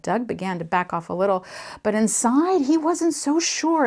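ordinary delivery

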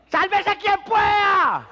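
very high, slow, loud panicked yell; panicked shout. Acted. The loud coward running off yelling, high shout. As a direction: scream it as you flee in panic. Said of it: "que sale gritando".

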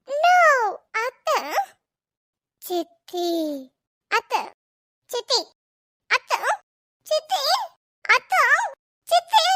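put-on childish spat building to a yelled Siddhi; escalating kids' quarrel. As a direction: bicker back and forth, getting shriller each time.

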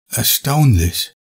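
understated amazement; touch of excitement. A narrator's reading. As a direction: let a little wonder slip out. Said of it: "mit einem Anflug von Begeisterung".

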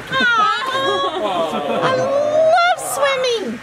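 high, slow gushing delight; overjoyed. Real, not acted. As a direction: stretch it out high with joy. Mom thrilled about having a pool.

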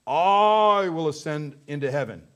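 long, loud opening I, like a proud boast; boastful Lucifer. Acted. Preacher quoting Lucifer defying God, boastful declaration. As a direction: swell hard on the first word, defiant. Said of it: "talking of Lucifer".

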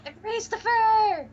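high, slow, drawn-out teasing chant; mock incantation. Friend teasing about cat in drawer, high slow mock chant. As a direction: egg the cat on in a high put-on voice.

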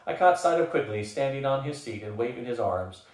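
plain reading voice

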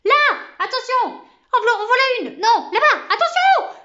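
frantic high-pitched shouts of alarm; cris divers. A narrator's reading. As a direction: yell warnings all over the place, high and loud. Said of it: "Un concert de cris divers". (cris d'alarme aigus et affolés)

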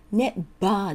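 "never" said as "neba", a B for the V; Japanese-style "never" with B and open "aa"; wrong B in never. Shown as a demonstration. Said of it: "neverではないですよ". (「never」を「ネバー」とBで発音)

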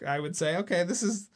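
usual speaking voice

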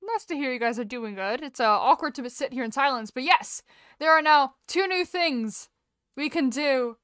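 ordinary delivery

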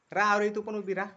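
calling out. Real, not acted. Uncle calls little Aarohi to stand up too, loudly. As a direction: raise the voice to call her over.

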